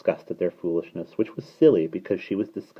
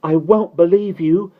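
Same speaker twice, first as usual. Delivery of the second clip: wildly insistent little voice; wild baby cry; 11 semitones higher, 7 dB louder than usual. A narrator's reading. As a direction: wail it as a defiant baby. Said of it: "cried Annabel wildly".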